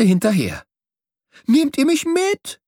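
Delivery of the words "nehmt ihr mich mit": high-pitched eager plea; excited call. A narrator's reading. Young bird begs passing swallows to take him, excited. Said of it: "rief ich aufgeregt".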